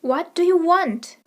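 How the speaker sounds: normal talking voice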